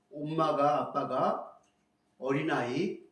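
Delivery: normal talking voice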